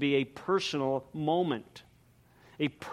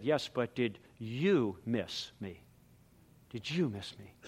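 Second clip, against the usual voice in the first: somber; 6 semitones lower, 9 dB quieter than usual. Retold or imitated. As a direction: ask it with quiet hurt. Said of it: "got kind of somber".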